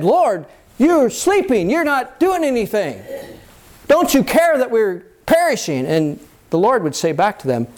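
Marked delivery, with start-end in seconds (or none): 0.0-5.9 high-pitched, dramatized cry for help; acted desperate appeal to a sleeping master; alarmed plea. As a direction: plead upward as if the boat is going down. Acting disciples' alarmed plea to Lord.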